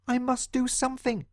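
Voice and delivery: high, small determined mouse; a tiny mouse making up his mind. Acted. John Mouse deciding to act, squeaky. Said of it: "he thought".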